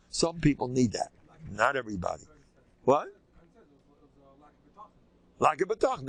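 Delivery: ordinary talk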